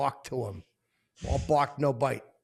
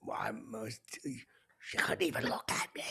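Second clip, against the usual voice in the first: menacing villain whisper; Joker whisper; 3 semitones lower, 8 dB quieter than usual. Retold or imitated. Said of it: "I'm like the Joker".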